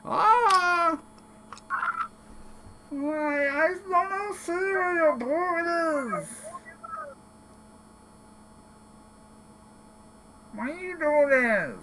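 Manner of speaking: very high, slow hurt whine; whiny kobold. Acted. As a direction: plead through the pain. Kicked kobold whining.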